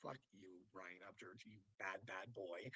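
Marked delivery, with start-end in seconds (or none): none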